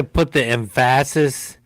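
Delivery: "emphasis" as em-FASS-is; stress on wrong syllable. Shown as a demonstration. Host joking about stress, "emphasis" hit on the middle syllable.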